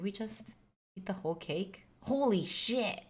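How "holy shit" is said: slow, stretched-out shock; surprised. Real, not acted. Dad noticing the cake is gone, slow surprised exclamation. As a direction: let the shock land slowly. Realising they ate whole cake.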